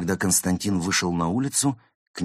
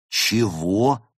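higher, louder puzzled question; surprised; 4 semitones higher, 6 dB louder than usual. Acted. Friends reacting to the cat's report, surprised. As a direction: blurt it out in disbelief. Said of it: "удивились все".

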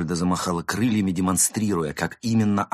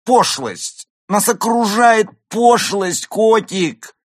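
high, loud, stretched tragic wailing; hammed-up despair, each word cried out; anguished outcry; 11 dB louder, much slower than usual. Drunk actress lamenting vulgarity to the cat, anguished outcry. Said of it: "вскрикнула Изольда с надрывом".

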